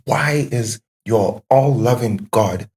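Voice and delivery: ordinary talk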